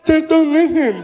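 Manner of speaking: high punchline in imitated deaf speech; partially deaf fan. Deaf fan advising comedian to ignore complaints, high imitated deaf voice. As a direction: deliver advice in a deaf man's voice. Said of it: "I'm partially deaf".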